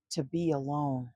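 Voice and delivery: plain reading voice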